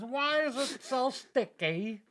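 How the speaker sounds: high, slow mock David Blaine; a hammed-up street magician; David Blaine impression. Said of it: "David Blaine".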